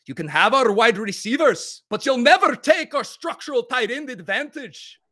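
put-on high, loud Braveheart battle cry in a mangled Irish-Scottish accent; rally the troops in a hammy Irish-Scottish brogue; loud, high-pitched mock Irish-Scottish accent; Irish-Scottish blend accent. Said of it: "Maybe a, an Irish-Scottish blend".

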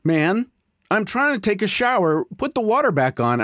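high, squeaky, offended critter; a hint of a put-out raccoon, mock indignant. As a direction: play the raccoon, annoyed you interrupted his shower. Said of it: "and he's like".

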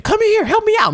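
very high-pitched imitated urgency; high comic pleading; mock frantic plea. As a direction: imitate a panicked man begging for help. Retells man's side of the stalemate.

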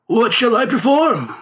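high, drawn-out storybook character; high-pitched showman addressing his betters; performer's voice. A narrator's reading. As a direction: ask it slowly in a high, theatrical voice. Performer asks mandarins for orders.

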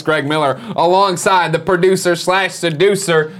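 ordinary talk, nothing marked

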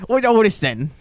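high and loud put-on Cockney voice; mock the dad's British greeting; British dad impression. Imitating friend's British dad.

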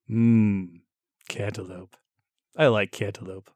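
low, hungry mmm over melon; dreamy craving. Acted. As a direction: savor the word like food. Host drifts off about melon.

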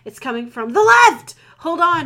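loud, high urgent shout; shouted order. Acted. Soldier shouts warning to unicorn under mortar fire. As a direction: bark the warning over the engine. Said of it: "he ordered".

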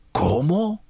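exclaimed in shock. A narrator's reading. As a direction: exclaim it on a sharp upward swing. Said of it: "gritó Polifemo".

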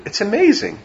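high, soft gushing over "amazing"; mock amazement. Teacher mimicking a thrilled new smartphone owner, gushing high. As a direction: sell the wonder in a soaring voice.

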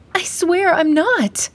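high, slow frantic denial; pleading denial. Acted. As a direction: insist desperately, voice up high. Accused girl denies, high pitched.

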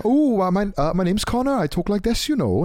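high, lilting mock Welsh accent. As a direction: put on a sing-song Welsh villager voice. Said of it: "they have, like, the accent".

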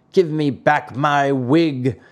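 slow, mildly surly demand; surly shout. A narrator's reading. Carpenter wants his wig back after the fight, mildly surly shout. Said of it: "shouted Mastro Antonio in a surly voice".

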